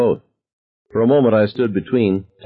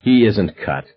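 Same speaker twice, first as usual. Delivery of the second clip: disgusted; slower than usual. A narrator's reading. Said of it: "Dad said disgustedly".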